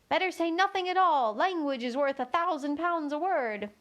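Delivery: a crowd thinking as one; lightly voiced chorus, sing-song; chorus of voices. A narrator's reading. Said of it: "they all thought in chorus".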